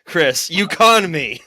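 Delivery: high, loud, stretched-out cry; mock indignant shout. Contestant protesting to Chris after the joke, loud mock indignation. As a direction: holler it at him in playful protest.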